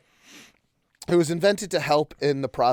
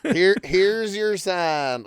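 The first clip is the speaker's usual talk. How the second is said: slow, lightly Southern punchline; 5 semitones higher, much slower than usual. Acted. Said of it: "That's not bad, right?".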